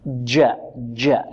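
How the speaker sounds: جاء with the jim pushed toward a dal, from the tongue tip; wrong jim sound. Shown as a demonstration. Teacher showing students the mistaken جاء. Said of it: "لا".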